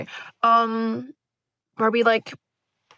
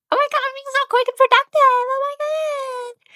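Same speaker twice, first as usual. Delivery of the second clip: very high, fast, chirpy gush; squealy self-praise. Pretend. Vlogger gushing to viewers in a squeaky, proud voice. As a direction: squeal with mock pride at her own speed.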